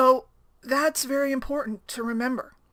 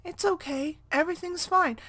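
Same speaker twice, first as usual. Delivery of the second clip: put-on higher-pitched voice soothing an upset child; 7 semitones higher than usual. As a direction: imitate a stranger gently calming a child. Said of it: "really soothingly talked to my son".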